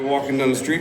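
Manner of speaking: ordinary talk, nothing marked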